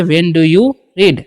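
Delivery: very slow, word by word; slow teaching model. Shown as a demonstration. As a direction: model it slowly for students. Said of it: "तो बोलेंगे". (बहुत धीरे, साफ़-साफ़ बोलकर)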